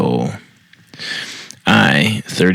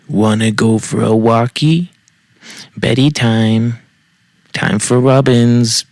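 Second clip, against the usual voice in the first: put-on baby talk; 3 semitones higher than usual. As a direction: coo it like talking to a toddler. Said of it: "does not care for baby talk".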